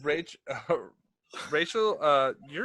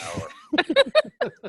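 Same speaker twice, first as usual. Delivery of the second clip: squeaky demon voice; 10 dB louder than usual. Acted. Demon's voice punchline.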